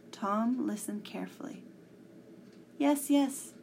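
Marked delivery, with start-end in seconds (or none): 2.8-3.5 high, eager cry; excited cry. Acted. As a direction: blurt it out excitedly. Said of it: "he cried".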